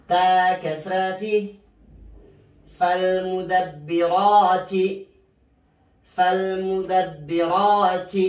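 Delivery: slow chanted tajweed recitation, spelling each letter; Quran recitation drill. Shown as a demonstration. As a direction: chant the letters and the word for students to repeat. Teacher chants spelling and Quran words. (ترتيل تعليمي بطيء مع تهجئة الحروف)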